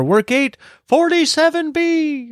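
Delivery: high-pitched calling out, no real volume; retold yell. Husband calling the gate to his wife while running off, mock yell. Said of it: "I yelling to her".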